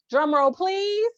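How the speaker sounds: high, bright announcer build-up; drawn-out pageant host reveal. Acted. Announces awards in raised voice.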